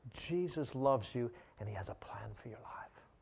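hushed, unvoiced words; whispered. Preacher whispering Jesus loves you to his critic, hushed. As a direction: lean in and breathe it softly. Whispered close of retold reply.

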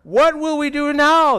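high, loud panicked plea; alarmed cry. A narrator's reading. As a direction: cry out to your master for help. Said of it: "the young man cried to Elisha".

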